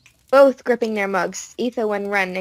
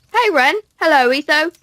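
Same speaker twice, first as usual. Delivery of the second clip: high cheerful voice; 7 semitones higher, 6 dB louder than usual. Acted. Mumbo greeting hermits.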